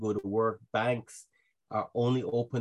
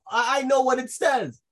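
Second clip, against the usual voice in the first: mock cocky, much higher and louder; smug know-it-all; 14 semitones higher, 8 dB louder than usual. Preacher mimicking a complacent Bible reader, mock cocky. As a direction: play the proud reader who thinks he's done. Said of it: "sit with the mindset".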